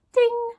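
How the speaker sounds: high, loud bell ding; imitated shop bell. A narrator's reading. Narrator voicing the door bell as they go inside, ding. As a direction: ring out like a doorbell.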